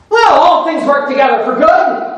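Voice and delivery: mock glib reassurance, pitched high; hammed-up breezy brush-off; dismissive platitude. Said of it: "He wasn't dismissive of them saying".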